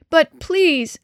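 loud, high-pitched begging; desperate child's appeal; pleading. A narrator's reading. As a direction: plead urgently with a grown-up. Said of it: "Denny pleaded".

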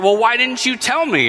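high, exasperated whine; frustrated complaint. Retold or imitated. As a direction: pitch up with frustration at the wife. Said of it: "I was so frustrated and aggravated".

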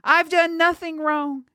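put-on high, pleading girl's voice. A narrator's reading. Girl accused as a witch, high pleading. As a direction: protest innocence in a high, pleading voice. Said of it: "she pleaded".

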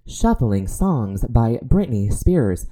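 slow, even smart speaker voice; Alexa voice. Retold or imitated. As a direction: answer like a voice assistant. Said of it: "And then she goes".

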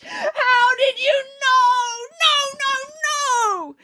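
very high, drawn-out tantrum shriek; screaming with rage. A narrator's reading. Little man hears his name guessed, screaming with rage. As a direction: shriek it out, stretching every word. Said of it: "suddenly screamed with rage".

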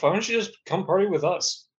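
ordinary delivery

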